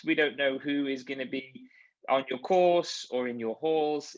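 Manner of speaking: normal talking voice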